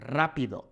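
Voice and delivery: rápido with stress on RA; stress on first syllable. Shown as a demonstration. Teacher gives the correct rápido, stressed on RA. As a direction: hit RA, let pido fall away in rápido.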